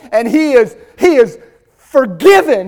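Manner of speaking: ordinary talk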